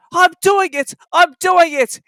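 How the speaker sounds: panting. Acted. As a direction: pant it out with effort. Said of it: "he panted".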